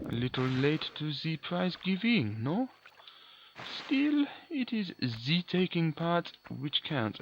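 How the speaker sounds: mock French villain line, drawn out; terrible French accent. Gamer quoting the villain's classic line in a bad French accent. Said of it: "A terrible French accent".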